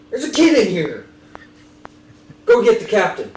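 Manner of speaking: shout. Acted. Sailor finds stowaway, calls out.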